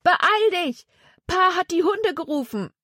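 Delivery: high-pitched urgent holler; yelled warning. A narrator's reading. Jonathan warning Zack about the hounds, high yell. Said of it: "schrie er".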